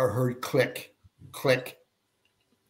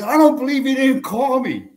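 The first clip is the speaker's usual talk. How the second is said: mimicking angry wife; 13 semitones higher, 7 dB louder than usual.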